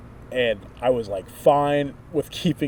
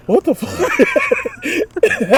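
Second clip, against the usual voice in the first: drawn-out, much higher shocked cry, slow; shocked brother; 9 dB louder, much slower than usual. Retold or imitated. Imitating a stunned brother, slow and loud. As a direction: brother bursting out of his room at the noise, shocked. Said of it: "my brother came out ... was like".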